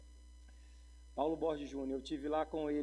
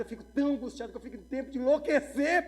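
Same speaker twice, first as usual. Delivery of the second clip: high, loud plea building to a peak; retold desperate outburst to a mentor; hammed-up anguish; 9 semitones higher, 8 dB louder than usual. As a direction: plead as if at wit's end. Acting out his anguished question to pastor.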